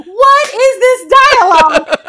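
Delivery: loud, high mock disbelief; incredulous outburst. Co-host reacting to the movie's writing, incredulous outburst. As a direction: cry it out in exasperated amazement. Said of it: "And I'm like".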